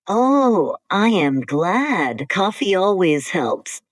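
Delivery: ordinary talk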